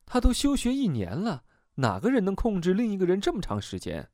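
high-pitched disbelieving question; incredulous objection. Acted. Therapist doubting anyone could control the patient, high and incredulous. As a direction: challenge it in lifted, puzzled disbelief.

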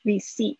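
receipt with no P sound, "ri-seet"; silent P. Shown as a demonstration. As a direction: leave the P out of receipt. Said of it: "但是不要念P出来".

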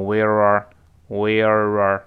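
"where" run slowly into "are" with a curled-tongue R; linked R sounds. Shown as a demonstration. Teacher demonstrates linking to students, slow linked R. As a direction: draw out "where-are" as one joined sound. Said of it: "就连到一块儿了".

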